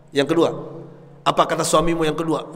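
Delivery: ordinary talk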